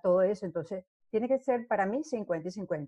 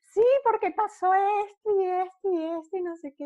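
much higher, louder eager granny voice; excited grandma character; 13 semitones higher, 6 dB louder than usual. Retold or imitated. Grandma covering her slip to the children, high loud eager voice. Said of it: "Yo hacía de abuelita".

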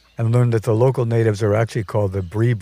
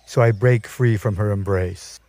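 plain reading voice

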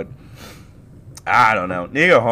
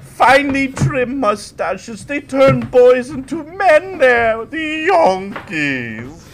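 much higher, slow hammed Dutch accent; 7 dB louder, slower than usual. Gushing as a Dutch Yankees fan, high Dutch accent.

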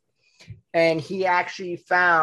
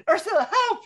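high, laughing plea; high pleading. Retold or imitated. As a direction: squeak for help. Quoting a character.

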